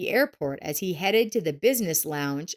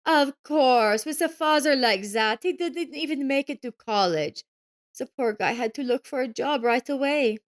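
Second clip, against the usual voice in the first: disdainful sneer; 6 semitones higher than usual. A narrator's reading. Ex-wife sneering at Joseph's son, disdainful. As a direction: pity the son with contempt. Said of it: "Evelyn wrinkled her nose".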